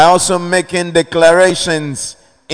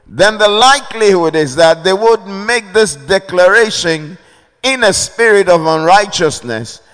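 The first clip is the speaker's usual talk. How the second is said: rising fervent preaching; 4 semitones higher than usual. Acted. Pastor warning the congregation about hostile declarations, fervent preaching. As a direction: pitch climbs higher, preaching with growing force.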